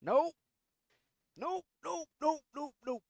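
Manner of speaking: high, loud frantic nopes; panicked nope. Real, not acted. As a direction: yelp it in panic. Closet door opens.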